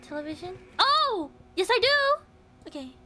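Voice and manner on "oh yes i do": delighted high exclaim; thrilled discovery; excited. Real, not acted. Finds key unexpectedly.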